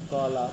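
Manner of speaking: কলা with both syllables given equal length; teacher's even-syllable কলা; syllable-timed demo. Shown as a demonstration. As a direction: say কলা evenly, neither syllable reduced. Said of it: "একই ধরনের ভাবে উচ্চারণ করি".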